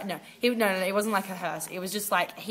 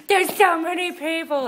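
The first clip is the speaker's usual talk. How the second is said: awed; 9 semitones higher, 5 dB louder than usual. Retold or imitated. Awe at stadium crowd.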